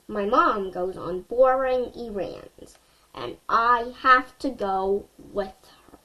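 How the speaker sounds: plain reading voice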